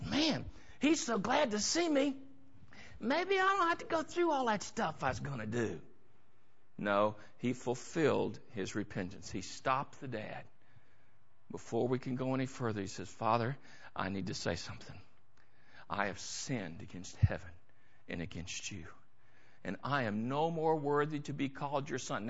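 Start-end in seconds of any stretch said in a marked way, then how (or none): 0.0-5.8 softer, sneaky inner voice; thinking he can skip the apology; son's sly thought. Acted. 13.1-18.8 much lower and softer, contrite; a son barely daring to speak; humble confession. Acted. Son confesses to his father. 19.6-22.3 softer, lower, broken-down son; bow your head and admit it quietly; humble confession. Acted. Son confessing before his father.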